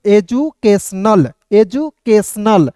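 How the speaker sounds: "एजुकेशनल" twice, slowly, as eju-kes-nal; slow, chunked repeat of "एजुकेशनल"; syllable-by-syllable reading. Shown as a demonstration. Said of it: "तो क्या हो जाएगा दोस्तों".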